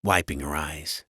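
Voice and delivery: plain reading voice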